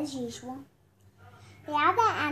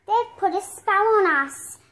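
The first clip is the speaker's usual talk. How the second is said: angry; 5 semitones higher, 7 dB louder than usual. Acted. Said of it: "they stomp off angrily".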